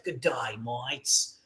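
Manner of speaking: low, slow Aussie drawl, Crocodile Dundee style; Australian accent. Retold or imitated. Imitating the Australian interviewer in a low Australian accent. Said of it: "the guy that's interviewing you is Australian".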